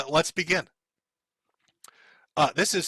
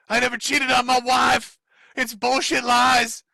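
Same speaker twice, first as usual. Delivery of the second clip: louder, higher shouted denial; furious denial; 6 semitones higher, 4 dB louder, slower than usual. Retold or imitated. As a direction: bark out the denial. Reading Pol's furious post.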